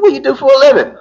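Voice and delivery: impatient cop repeating himself; insistent repeat. Retold or imitated. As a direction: push the question harder, not buying the lie. Cop repeats, not buying it.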